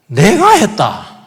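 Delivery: 자랑하듯 느리게 내가 했다; proud boaster. Retold or imitated. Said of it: "이러면 이 감사가 없어요". (교만한 사람 흉내)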